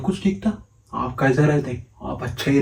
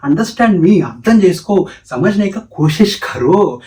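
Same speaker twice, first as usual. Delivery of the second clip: raised, louder insistent plea; acted frustration, pitched up; exasperated demand; 6 semitones higher, 5 dB louder, slower than usual. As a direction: press the demand loudly in exasperation.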